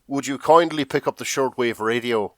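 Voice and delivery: a hint of put-on Irish; lightly attempted Irish lilt; Irish accent. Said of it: "attempt to do an Irish accent".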